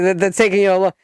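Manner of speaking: usual speaking voice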